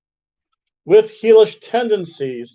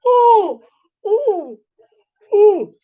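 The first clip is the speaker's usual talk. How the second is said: high, wincing "oh" cries; imitating her flinching at the hits; girlfriend's pained reaction; 13 semitones higher, 4 dB louder, much slower than usual. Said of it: "she would still".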